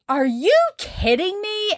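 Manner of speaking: high, slow outraged call; high, slow disbelief at customers who skipped the tip. Retold or imitated. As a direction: shout after them in disbelief, pitched high. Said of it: "So I'm running outside, and I'm like".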